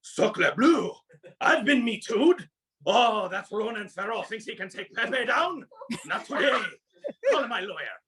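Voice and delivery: a hammed-up French skunk in outrage; french chef. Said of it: "and he's just like".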